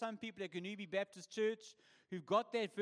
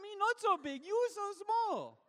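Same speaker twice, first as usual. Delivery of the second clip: mock broken-English reply; 12 semitones higher, 6 dB louder than usual. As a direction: play the joke line again. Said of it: "didn't have the heart to say".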